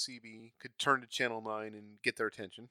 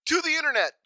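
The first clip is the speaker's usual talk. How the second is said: high, loud heroic call; rallying cry; 10 dB louder than usual. Real, not acted. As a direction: charge off to look it up.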